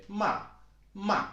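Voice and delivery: "ma" falling from a moderately high start; unexaggerated fourth tone. Shown as a demonstration. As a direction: say "ma" as a short, everyday falling tone. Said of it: "don't actually have such a high-pitched voice".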